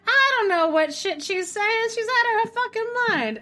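high, exasperated mock Jim; imitating Jim. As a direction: squeak out his disbelief. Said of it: "And Jim's like".

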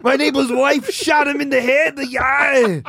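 high, hammed-up action-hero wail; Arnold Schwarzenegger. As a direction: shout the news in a thick Austrian accent. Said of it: "Arnold's character in Jingle All the Way".

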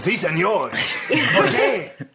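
put-on hammy tourist Spanish accent; mock Spanish, laughing; fake Spanish. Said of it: "kommer hem och".

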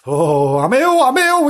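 rising, loud, high-pitched mock moan; pained wail. A narrator's reading. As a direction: wail it out like a bellyache. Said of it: "He had a dreadful pain".

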